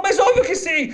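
put-on a girl eagerly accepting; delighted little girl. (menina aceitando feliz, aguda)

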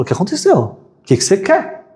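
high startled what; dad startled by his son; startled father. Acted. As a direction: jolt up in surprise.

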